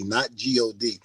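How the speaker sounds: usual speaking voice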